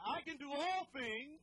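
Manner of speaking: slow, loud, emphatic declaration; said like you mean it. Shown as a demonstration. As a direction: proclaim it with conviction. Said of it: "say it like you mean it".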